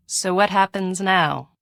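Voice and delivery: ordinary talk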